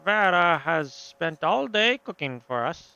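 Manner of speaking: put-on drawn-out theatrical European accent. Host announcing Vera's cooking to guests, slow put-on accent. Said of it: "I'm giving him that accent".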